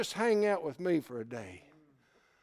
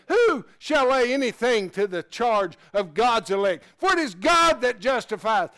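loud, high, ringing scripture read; preaching at full force; 7 semitones higher, 12 dB louder than usual. Acted. Preacher booming scripture to the church. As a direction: thunder the verse out to the congregation.

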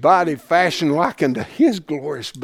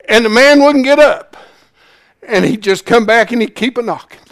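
louder, higher preaching; preaching build; 8 semitones higher, 7 dB louder than usual. Real, not acted. Preacher retelling the parable to the church, building up. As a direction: lift it into preaching again.

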